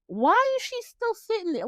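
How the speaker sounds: high-pitched, incredulous question; exasperated disbelief. Retold or imitated. Viewer recalling her reaction to Billy staying in the limo, exasperated disbelief.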